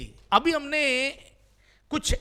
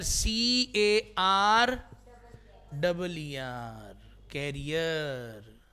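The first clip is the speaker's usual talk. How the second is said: spelled out slowly; 4 semitones lower, much slower than usual. Shown as a demonstration. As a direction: spell it letter by letter, then stretch the word. Spells out the word, then says it.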